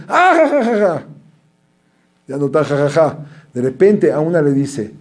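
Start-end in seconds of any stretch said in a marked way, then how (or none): 0.1-1.1 mock girlish laughter. Classmates laughing.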